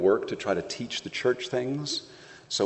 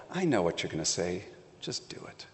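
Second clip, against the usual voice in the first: 1 mother's hushed order; 3 semitones lower, 7 dB quieter than usual. Retold or imitated. Mary's look to her son.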